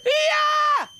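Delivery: very high, loud mock battle cry; a hammed-up karate shriek; kung fu yell. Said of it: "My mother went up to me".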